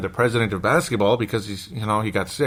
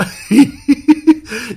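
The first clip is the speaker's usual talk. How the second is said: high, loud shrieking laugh; loud burst of laughter; 10 dB louder than usual. Real, not acted. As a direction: crack up loudly.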